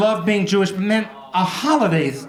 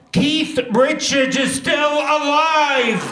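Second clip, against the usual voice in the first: belted punchline; 7 semitones higher, much slower than usual. Acted. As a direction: proclaim it like a miracle.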